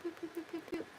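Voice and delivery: soft, high little boops; playful boops. Real, not acted. Streamer fiddling with incense, playful soft boops. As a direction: boop quietly in a tiny high voice.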